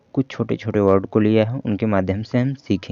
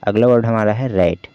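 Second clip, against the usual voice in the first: ordinary talk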